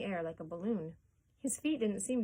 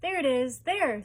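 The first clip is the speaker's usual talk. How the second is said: high, loud pointing shout; 5 semitones higher, 9 dB louder than usual. A narrator's reading. Someone in the crowd shouts on spotting the ticket. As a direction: point and yell it out. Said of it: "someone shouted".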